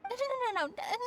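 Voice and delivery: flustered backpedal. Acted. Realizing she has rambled on about gem prices, flustered. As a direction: catch yourself mid-rant and wave it off in a hurry.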